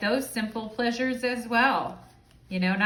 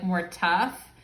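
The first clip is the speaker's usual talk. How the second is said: ordinary talk, nothing marked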